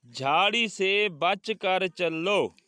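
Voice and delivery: very slow, word-by-word classroom reading; deliberate, projected teacher's dictation; slow model reading. Shown as a demonstration. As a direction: spell out each word for pupils to echo. Reads sentence for class to repeat.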